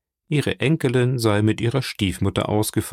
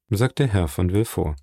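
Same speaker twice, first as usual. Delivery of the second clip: ordinary delivery, nothing marked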